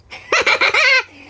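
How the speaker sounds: high loud delighted laugh; gleeful laugh. Real, not acted. Recalling neighbour praising her letter, gleeful laugh.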